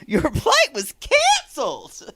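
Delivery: high, mock-cheerful exclamation with a laugh; slow it down and hammer the exclamation mark. Reading out Maria's message with its exclamation mark, mock excitement. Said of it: "exclamation mark".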